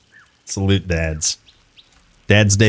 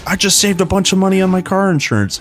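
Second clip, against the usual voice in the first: high, quick mock-excited announcement; trolling punchline; 10 semitones higher, faster than usual. Son trolling Dad after calling him over, mock-urgent. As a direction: deliver the prank as urgent news. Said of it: "and I would be like".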